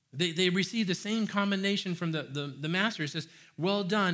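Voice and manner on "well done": a bright, excited well done; louder, higher praise. Retold or imitated. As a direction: cheer them on. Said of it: "spoken with excitement".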